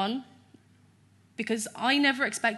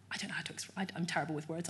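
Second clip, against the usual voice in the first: overwhelmed whisper; 4 semitones lower, 9 dB quieter, faster than usual. Real, not acted. Overwhelmed while describing her healing.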